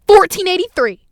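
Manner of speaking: high, loud order call; imitated irate shout; retold yell. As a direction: bark the number like an angry cashier. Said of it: "She goes".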